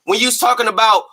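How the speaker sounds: ordinary talk, nothing marked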